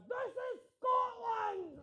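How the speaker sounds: slow, high hammed-up shout; angry Scot. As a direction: bellow it like a proud Scot. Scottish man shouting at comic.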